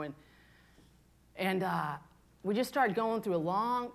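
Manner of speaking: ordinary delivery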